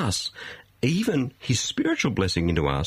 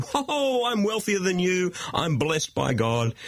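high, drawn-out crowing, sliding down; mock boasting; 8 semitones higher, slower than usual. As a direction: imitate smug braggarts lording it over others. Mimics wealthy people bragging.

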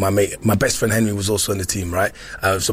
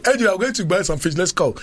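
lightly voiced parent; 8 semitones higher than usual. Parent dragging him out at dawn.

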